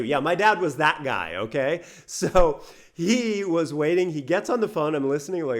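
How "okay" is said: plain reading voice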